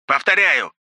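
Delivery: high, loud amplified call; loudspeaker voice. A narrator's reading. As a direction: shout through a loudspeaker. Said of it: "раздалось из громкоговорителя".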